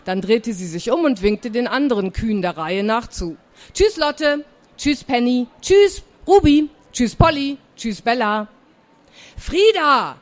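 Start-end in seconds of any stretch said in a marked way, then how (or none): 3.7-8.5 higher-pitched roll of farewells; child's goodbye calls. Acted. Girl waves to each cow in turn, calling goodbye. 9.4-10.2 higher-pitched, drawn-out angry call; cross shout. Acted. Aunt has had enough of waiting, cross calling. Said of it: "jetzt war sie wirklich langsam sauer".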